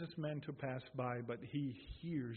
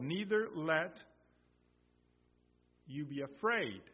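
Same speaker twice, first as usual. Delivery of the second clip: loud, ringing, higher sermon voice; full-force preaching; 5 semitones higher, 7 dB louder than usual. Acted. Preacher quoting Jesus to the congregation, loud and fervent. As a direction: proclaim the verses with full conviction.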